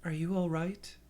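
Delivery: put-on high, small child's voice; little girl voice. A narrator's reading. Little girl speaks to narrator.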